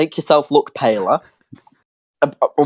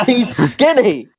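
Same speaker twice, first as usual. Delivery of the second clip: mocking emphasis; 6 semitones higher, 5 dB louder, slower than usual. Mocking Slenderman.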